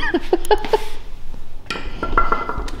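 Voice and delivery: airy unvoiced laugh; breathy high giggle. Real, not acted. Laugh after joke.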